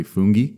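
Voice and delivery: "fungi" said as FOON-ghee, hard g and ee ending; correct pronunciation. Shown as a demonstration. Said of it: "I believe the correct".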